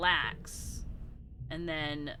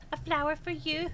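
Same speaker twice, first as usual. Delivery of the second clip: higher, sing-song repeat of the offer; cute high voice; 7 semitones higher than usual. Acted. Streamer echoing the line to a friend, cute and high. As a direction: chirp it like a cute little creature.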